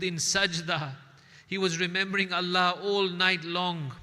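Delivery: loud fervent preaching; impassioned retelling of the night of prayer; fervent recollection. Acted. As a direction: proclaim it with rising fervour.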